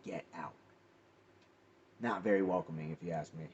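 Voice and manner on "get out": hushed, voiced mimicry of a whisper; imitated whisper. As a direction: quote the ghost softly, half-whispering. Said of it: "I hear a clear whisper".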